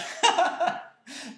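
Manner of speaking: sudden high burst of laughter; a big helpless laugh; loud laugh. Real, not acted. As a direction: crack up out loud.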